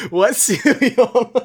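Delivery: high, slow laughing squeal. Real, not acted.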